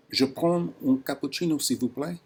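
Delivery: put-on English-accented French, spoken up and clearly to the voice app; café customer ordering from the AI barista, loud and careful. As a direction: order a coffee out loud, over-clear for the recognizer. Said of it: "a cappuccino".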